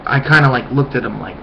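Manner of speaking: ordinary delivery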